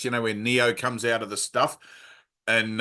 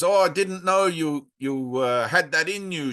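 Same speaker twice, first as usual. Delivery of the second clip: high, lilting attempt at a Dutch accent; Greta's Dutch accent; 5 semitones higher than usual. Mentor surprised by her first formal talk, faked Dutch accent. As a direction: imitate a Dutch mentor, accent badly done. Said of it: "I can't do a Dutch accent very well".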